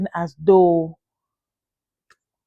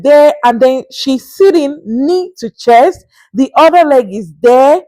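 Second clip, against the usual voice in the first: slow, raised, disbelieving; exasperated; 5 semitones higher, 5 dB louder, slower than usual. Real, not acted. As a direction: drag the words out in outrage. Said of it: "describing rude pose".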